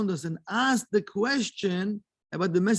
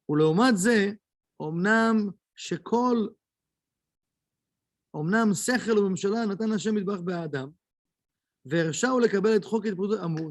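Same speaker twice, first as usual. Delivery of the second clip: quick recitation of a memorised text; reading the Hebrew text; much faster than usual. Acted. Teacher reading the Kabbalah text aloud to students, fast recitation. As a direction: rattle off the Hebrew source at speed.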